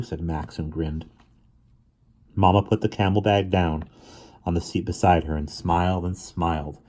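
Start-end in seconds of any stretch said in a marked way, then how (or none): none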